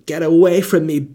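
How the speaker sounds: hammed-up "get away"; mock dramatic rejection. Acted melodramatic rejection.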